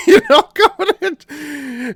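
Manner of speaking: high-pitched, slow words through laughter; laughing while talking. Real, not acted. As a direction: crack up mid-description. Cracks up describing the sketch.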